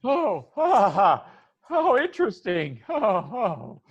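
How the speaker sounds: high, awkward mock laughter; high-pitched, put-on "oh, interesting"; forced polite laugh. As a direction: laugh along politely at a joke. Guest's polite laugh at skeleton joke.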